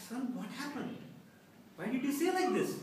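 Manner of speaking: high-pitched imitated tearful plea; mock sobbing mother. Mother questioning her son afterwards, sobbing voice. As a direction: wail the question, lightly tearful. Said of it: "asked me in a sobbing voice".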